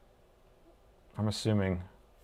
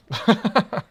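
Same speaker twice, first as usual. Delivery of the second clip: high laugh; 11 semitones higher, 5 dB louder than usual. Real, not acted. Laughs at own pessimism.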